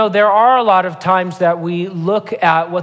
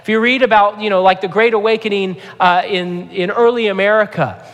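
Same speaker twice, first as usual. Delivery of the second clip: preaching at full force; 5 semitones higher than usual. Acted. Pastor warning the church about man-made rules, preaching with rising force. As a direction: preach it out, pressing harder line by line.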